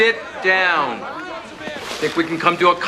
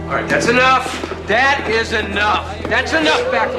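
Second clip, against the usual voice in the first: raised voice; 6 semitones higher than usual. Acted. Breaking up a fight.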